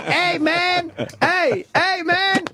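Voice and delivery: high-pitched mock yell; imitated heckling yell. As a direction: call out like inmates heckling from windows. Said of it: "they would yell shit like".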